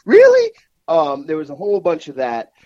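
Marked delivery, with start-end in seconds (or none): 0.0-0.5 high, loud bursts of stunned disbelief; incredulous yelps, much higher; mock disbelief. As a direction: act out getting shocking news on the phone. Retelling stunned calls and texts.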